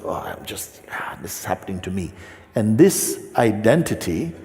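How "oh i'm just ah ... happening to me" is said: barely voiced whisper of a self-pitying inner voice; hushed self-pity. The identified self sighing to itself, whispered. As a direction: breathe it out, softer, as a whisper. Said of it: "the one that says".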